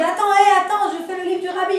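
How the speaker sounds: high, loud call back down; shouting back. Retold or imitated. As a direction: holler back to make him wait.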